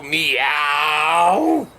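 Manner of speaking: a lightly voiced, long drawn-out meow; a low, lazy cat's meow; ordinary cat meow. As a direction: meow like any normal cat. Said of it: "most cats go".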